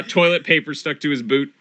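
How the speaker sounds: normal talking voice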